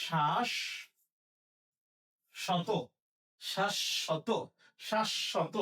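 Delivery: শ্বাস শত broken as "shash-shoto"; doubled sh sound. Shown as a demonstration. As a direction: hold the sh between শ্বাস and শত. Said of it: "উচ্চারণ হবে".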